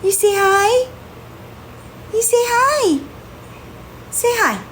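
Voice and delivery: high sing-song pet voice. Real, not acted. Coaxing cat to greet.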